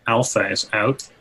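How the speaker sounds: normal talking voice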